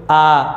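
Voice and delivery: आ held with lips spread open, unrounded. Shown as a demonstration. Teacher contrasting lip shapes for students, unrounded आ. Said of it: "आ बोलोगे तो होठ इधर उधर फैल रहे हैं".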